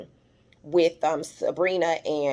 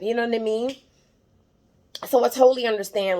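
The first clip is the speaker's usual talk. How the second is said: ordinary talk, nothing marked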